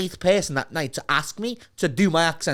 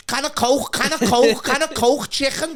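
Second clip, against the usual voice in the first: high, loud mock begging for an accent; pestering Southerners; 10 semitones higher, 5 dB louder than usual. Southerners asking a Scouser for the accent, high excited pestering. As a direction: imitate an over-keen stranger, pitched way up. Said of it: "they're like".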